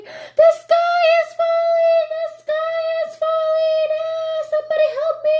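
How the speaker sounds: high, loud panicked scream. Acted. Fox fleeing the wreck, high panicked scream. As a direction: shriek it in terror.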